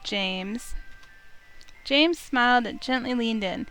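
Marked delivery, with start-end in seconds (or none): none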